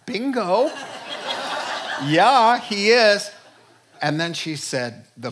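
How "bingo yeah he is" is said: 1 triumphant reply. Retold or imitated. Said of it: "I went".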